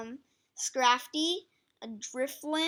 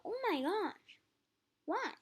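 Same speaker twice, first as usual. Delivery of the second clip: disbelief; 8 semitones higher, 4 dB quieter than usual. Real, not acted. Disbelief at ranking.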